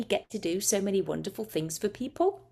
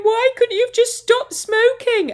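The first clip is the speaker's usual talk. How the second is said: much higher, louder tearful plea; imitating crying wife; 8 dB louder than usual. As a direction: wail it like a grieving widow. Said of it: "and she's crying".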